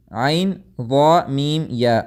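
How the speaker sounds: the letters "عین ضا میم یا" named one by one, very slowly; slow letter spelling. Shown as a demonstration. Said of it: "الحنق العظمی".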